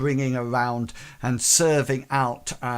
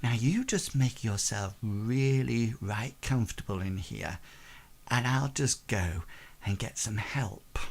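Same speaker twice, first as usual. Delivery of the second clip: coy tavern hostess; 2 semitones lower, 6 dB quieter than usual. Acted. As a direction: settle the guests in softly and suggestively. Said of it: "and she says".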